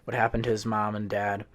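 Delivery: ordinary delivery, nothing marked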